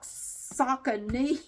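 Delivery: Saucony stretched out slowly, SOCK-uh-NEE; Google's pronunciation. Shown as a demonstration. Vlogger spelling out Google's version by sound, slow SOCK-uh-nee. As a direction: draw out Saucony as sock-uh-nee, rising at the end. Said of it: "this is pronounced Saucony, like".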